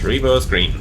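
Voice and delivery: usual speaking voice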